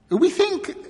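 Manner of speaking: very high, loud nervous start; flurried. A narrator's reading. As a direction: blurt it out, flustered. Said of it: "in a flurried voice".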